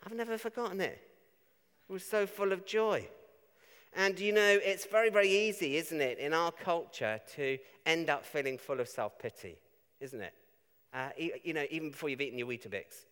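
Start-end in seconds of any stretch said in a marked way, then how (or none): none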